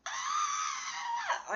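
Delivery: high piercing scream; retold scream. Retelling running into the house, a high scream. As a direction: shriek as if in pain. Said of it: "run into the house screaming".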